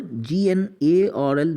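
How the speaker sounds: G N A R L spelled letter by letter, slowly; slow spelling. Shown as a demonstration. Teacher spelling the word slowly for the class. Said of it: "यानी नर्ल".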